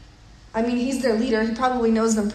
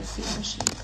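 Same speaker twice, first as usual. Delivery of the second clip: whispered charge; 8 dB quieter than usual. Retold or imitated. Jesus answering Peter a third time, whispered. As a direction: whisper it, soft and unvoiced. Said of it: "And Jesus says again".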